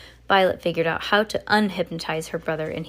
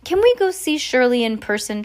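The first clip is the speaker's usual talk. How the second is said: timid, higher-pitched request; 6 semitones higher, 4 dB louder than usual. A narrator's reading. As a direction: ask meekly in a raised voice. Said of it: "Charles said timidly".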